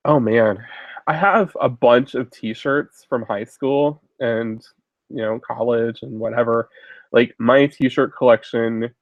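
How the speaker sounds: usual speaking voice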